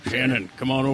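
close-mic creepy come-on. Acted. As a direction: lure them in, creepy and close. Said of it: "Will you please do that? Go".

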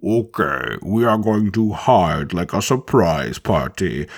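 low, slow, muffled alien boss voice; Jabba voice. Retold or imitated. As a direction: drawl it like a bloated slug crime lord. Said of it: "he basically instructing everyone to be like".

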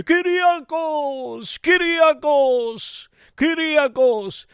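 drawn-out, high-pitched call; high, stretched summons. Acted. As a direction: call the name slowly from afar. Gods calling the narrator's name.

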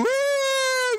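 a loud, high yelp of alarm; panicked whoop. Acted. Believer stepping out in faith, yelping in loud high alarm. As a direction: squeal in fright mid-step. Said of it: "and we go".

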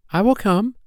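very high, rising little voice; eager cry. A narrator's reading. Poppy Pink agreeing to leave fairyland, eager cry. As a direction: pipe up high and determined. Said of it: "cried Poppy Pink".

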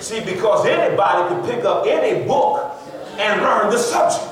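high-energy sermon delivery, key phrases stretched; forceful preaching. Real, not acted. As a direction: drive it home to the congregation, drawing out the big words.

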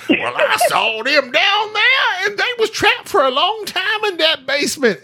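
high, hammed-up Southern twang; horrible Southern accent. Mock witness on the news about a basement, Southern accent. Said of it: "a southern horrible accent".

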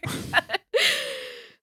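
squealing laugh, high and bright; high-pitched laugh. Real, not acted. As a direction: let out a high giggle. Laughs after car story.

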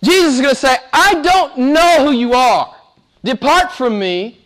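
high-pitched, thundering pronouncement of judgement; full-force preaching. Acted. Preacher voicing Jesus turning away the lawless, high full-force preaching. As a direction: preach it as a ringing condemnation.

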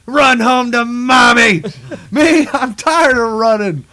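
cocky hero taunt. Retold or imitated. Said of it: "He's like".

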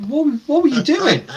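high, slow, laughing disbelief; incredulous. Retold or imitated. Calling up the worker.

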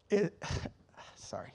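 real choked-up apology with a sigh; emotional. Real, not acted. Apologizing to listeners while overcome, emotional. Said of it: "I get emotional a little bit".

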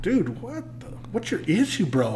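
mock surprise. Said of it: "And I'm like".

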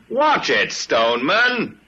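sharp warning shout, high. Real, not acted. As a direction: yell a warning at the driver. Warning driver in truck.

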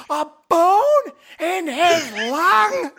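high, drawn-out incredulous yell. Retold or imitated. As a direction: yell it slowly in high-pitched disbelief. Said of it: "I yell out".

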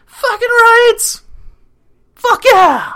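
high, loud, drawn-out celebratory shout; O'Reilly. Retold or imitated. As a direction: holler it like a player lifting the Cup. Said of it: "Here's O'Reilly".

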